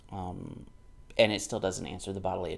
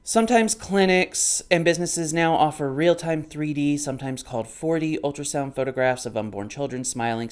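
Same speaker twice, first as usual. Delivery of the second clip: louder, higher reading voice; book read-aloud; 4 semitones higher, 8 dB louder than usual. Acted. Vlogger reads the ultrasound passage from the book, in a louder reading voice. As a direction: read the passage out clearly to the camera.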